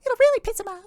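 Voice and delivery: very high squeaky sidekick voice, egging on; gravelly sly hobgoblin. Acted. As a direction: squeal agreement like a sly little goblin. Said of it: "And then you hear a".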